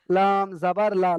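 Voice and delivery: লাম জাবার লা slowly, long আ on লা; slow drilled reading. Shown as a demonstration. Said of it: "প্রত্যেকটা অক্ষর কিন্তু আকার উচ্চারণ আসছে". (ধীরে লাম জাবার লা, লম্বা আকার)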